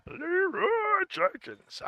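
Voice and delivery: very high, drawn-out falsetto cry; badly faked battle cry, squeaked slowly; Leroy Jenkins. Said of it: "I can't do that voice".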